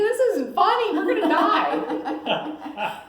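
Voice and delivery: very high, loud, laughing panic, last words slow; mock panic. Wife on the plane snapping at her laughing husband, high mock panic. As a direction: squeal half laughing, then drag out the doom. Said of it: "And I'm thinking".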